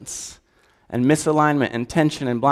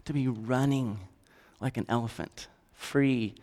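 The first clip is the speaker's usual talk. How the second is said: tender God voice; 3 semitones lower, 12 dB quieter than usual. Acted. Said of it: "God looks at you and says".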